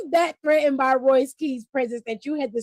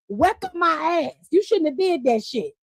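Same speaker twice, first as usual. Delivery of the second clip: usual speaking voice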